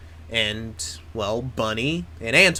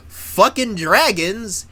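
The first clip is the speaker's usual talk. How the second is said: slow, high, loud relish on each word; excited hype; 7 semitones higher, 5 dB louder, much slower than usual. Real, not acted. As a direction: draw it out loudly, hyped. Hypes dragons in the plot.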